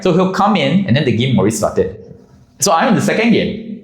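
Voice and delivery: ordinary delivery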